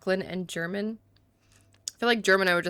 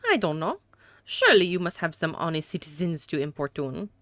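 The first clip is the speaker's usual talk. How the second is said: hammed-up French accent for a haughty suspect. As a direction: play a disdainful gentleman with a badly faked French accent. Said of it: "these atrocious accents".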